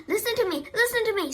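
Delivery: high, insistent cry; mild storybook scream. A narrator's reading. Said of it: "screamed the goose".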